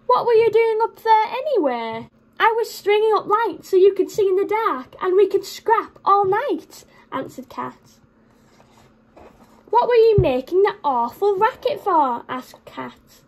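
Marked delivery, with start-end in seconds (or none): 0.1-6.8 story voices. Acted. Dog and cat quarrel. 9.7-12.2 cross cat. Acted. Cat scolds dog.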